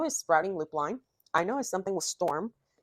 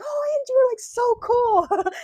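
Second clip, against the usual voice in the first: much higher, louder and slow, gushing over meeting him; fangirl squeal; 8 dB louder, slower than usual. Retold or imitated. Retelling meeting a favourite YouTuber, starstruck squeal. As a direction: squeal his name like a starstruck fan. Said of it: "I'm like".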